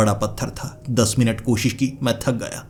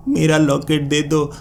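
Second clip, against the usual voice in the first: higher, wailing plea; pleading cry; 5 semitones higher than usual. Acted. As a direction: beg tearfully at his feet. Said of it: "वो रोने लगी".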